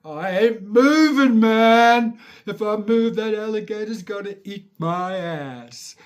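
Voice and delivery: high, loud frightened protest; mock terrified kid. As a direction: act out a scared child refusing to move. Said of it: "I'd lay in bed terrified".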